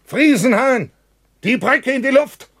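loud, high shout across the tunnel. Acted. Bratke calls to Friesenhahn from the entrance, shouting. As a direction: yell the order to blow the bridge. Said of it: "und ruft".

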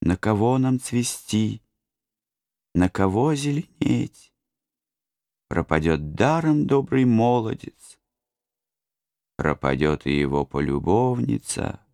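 sing-song grieving lament; voice of the trees. A narrator's reading. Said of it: "будто желая сказать".

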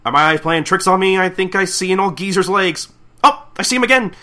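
loud, high disbelieving commentary; astonished announcer. Acted. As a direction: announce a sight you can't believe. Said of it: "the announcer's just like".

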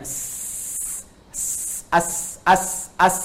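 as held on a voiceless hiss; as with silent s. Shown as a demonstration. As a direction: put a glottal a in front and hiss the s. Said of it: "Anda sukunkan hurufnya".

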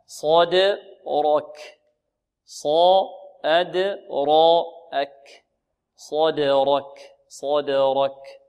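"صدرك" very slow, heavy sad and ra, bounced d; slow syllable drill. Shown as a demonstration. Teacher modelling the word for students, very slow syllables. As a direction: stretch and separate each sound of "صدرك". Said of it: "هكذا".

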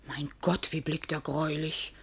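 hushed fright. Acted. Maid shaken after master leaves, hushed fright. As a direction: breathe out the fear.

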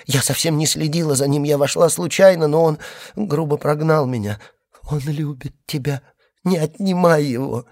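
high, broken, pleading sobs; hysterical crying. A narrator's reading. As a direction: beg through hysterical tears. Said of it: "с новым припадком истерического плача, прерывающимся голосом".

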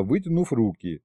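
plain reading voice

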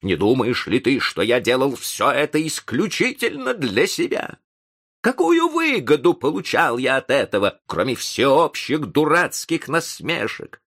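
high, indignant tirade. A narrator's reading. Professor rebukes the ungrateful young man, raising his voice in anger. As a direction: raise the voice and hammer out the grievance. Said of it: "повышая голос".

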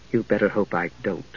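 low, quiet warning; cool. Acted. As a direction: answer coolly, keeping it soft. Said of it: "Palin said coolly".

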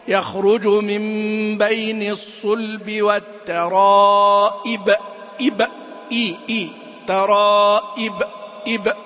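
slow chant, "والترائب" ending in clear -ib with bouncing b; firm kasra before qalqala. Shown as a demonstration. Teacher chanting the verse, firm kasra before echoed final letter. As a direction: draw out the recitation, pure i in "إب". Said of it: "وإذا كان الحرف الأخير مقلقلا".

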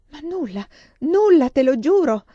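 high, wailing protest growing loud; anguished, imploring wail; moaning plea. A narrator's reading.

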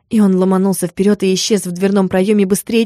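usual speaking voice

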